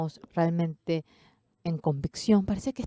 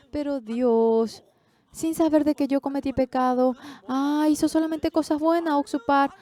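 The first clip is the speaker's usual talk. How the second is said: higher and louder, building to a proclamation; preaching at full force; 8 semitones higher, 4 dB louder than usual. Acted. Preacher proclaiming to his congregation that God calls him just, rising in force.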